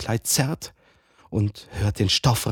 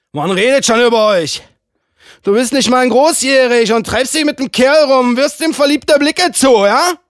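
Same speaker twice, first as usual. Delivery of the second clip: hoch und laut, vorwurfsvoll geschrien; wütender Vorwurf; 14 semitones higher, 10 dB louder than usual. Acted. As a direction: schleuder ihr die Vorwürfe entgegen. Mann wirft ihr Gerede vor. (hoch, laut und empört)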